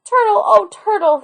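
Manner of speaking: high, excited splish splash; a boy crying out with delight; joyful cry. Acted. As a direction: shout for joy at the turtle. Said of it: "he cried".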